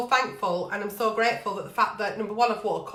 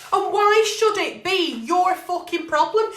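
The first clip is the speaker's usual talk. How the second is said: normal talking voice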